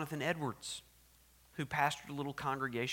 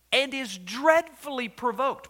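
loud, high, slow thundering line; thunderous quote; 8 semitones higher, 9 dB louder than usual. Retold or imitated. Preacher quoting Edwards, thunderous. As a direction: boom it out. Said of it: "He said".

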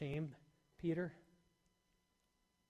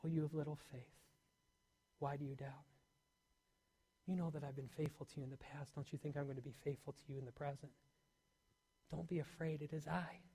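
much softer, gentle and close; soft, close to the ear; 2 semitones lower, 8 dB quieter than usual. Pretend. Jesus comforting drowning Peter, soft intimate murmur. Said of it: "just whispering into his ear".

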